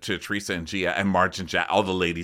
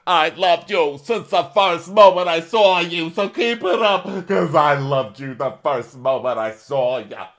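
mock Joe Gorga; 8 semitones higher, 8 dB louder than usual. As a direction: ham up the wounded uncle, much higher and louder. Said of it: "He's like".

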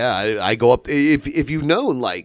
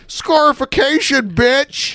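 mocking; 13 semitones higher, slower than usual. Mock punchline retort.

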